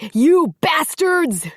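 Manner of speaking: very high, loud, slow cursing yell; cursing his attackers; drawn-out furious roar; enraged curse. A narrator's reading. Archie trampled by horses.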